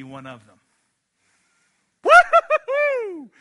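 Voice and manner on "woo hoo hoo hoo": long high whoop, very loud; whooping cheer. Acted. Preacher whooping at his own point, loud cheer. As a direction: whoop like a fan in the pews.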